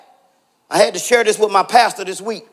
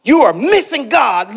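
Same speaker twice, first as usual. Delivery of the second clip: ordinary talk, nothing marked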